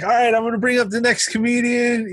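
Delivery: high-pitched stage emcee intro; club host. Retold or imitated. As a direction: announce the next act to the room. Host introduces next comic onstage.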